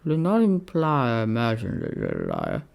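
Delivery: calm Roman correcting his friend. A narrator's reading. Octavius voiced.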